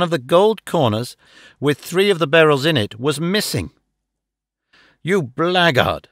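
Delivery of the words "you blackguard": ordinary delivery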